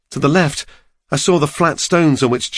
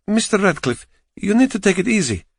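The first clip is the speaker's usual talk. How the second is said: put-on a light Eastern European accent, higher, kindly nurse; 9 semitones higher than usual. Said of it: "She had an Eastern European accent".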